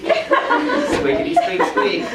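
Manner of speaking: very high squeaky squirrel voice, slow and airy. Acted. Teacher voicing a squirrel for the class, very high squeak.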